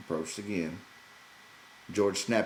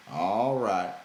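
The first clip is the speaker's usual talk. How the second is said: louder, curt; brusque; 2 semitones higher, 7 dB louder than usual. A narrator's reading. George to Lenny back with one stick, brusque and louder. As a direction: cut it off short and firm. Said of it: "he said brusquely".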